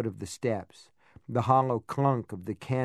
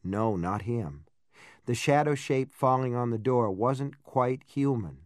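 usual speaking voice

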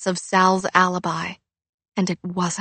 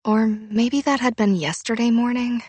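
ordinary delivery

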